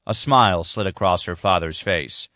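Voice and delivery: ordinary delivery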